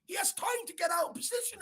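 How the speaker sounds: high, loud outraged question; incredulous shout. Real, not acted. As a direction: yell in disbelief at the keeper. Fan berating the goalkeeper.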